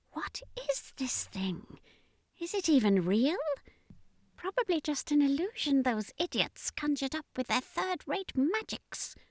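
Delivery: high, soft, alien murmur, irritated; a sneering alien muttering contempt; high hushed alien voice, annoyed; angry alien commander. Acted. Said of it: "the commander thought in anger".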